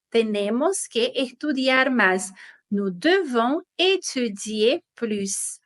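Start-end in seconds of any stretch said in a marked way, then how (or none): 2.7-5.6 slow, careful repeat of "Nous devons étudier plus"; slow model sentence. Shown as a demonstration. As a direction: spell it out slowly for students. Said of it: "teacher repeating the example slowly".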